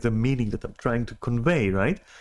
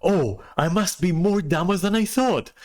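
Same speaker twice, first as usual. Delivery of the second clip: mocking her; 7 semitones higher than usual. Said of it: "she goes and says things like".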